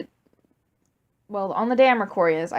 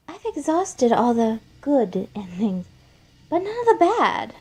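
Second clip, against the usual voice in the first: put-on Flowey, sinister child; 4 semitones higher than usual. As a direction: savor the threat, ending in a high childish lilt. Flowey threatens Asgore.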